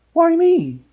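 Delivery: high, loud indignant squeal; girl objecting to being chosen. A narrator's reading. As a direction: protest in outrage. Hermione protests.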